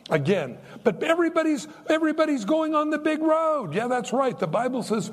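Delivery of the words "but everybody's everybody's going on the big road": a higher voice for the imagined objector; higher voice of a doubting listener; mock objector. Voicing an objector.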